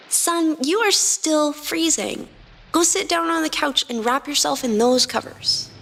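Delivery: Lily ordering the freezing Sun to the couch. A narrator's reading. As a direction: order him to the couch, caring but firm. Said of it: "she ordered".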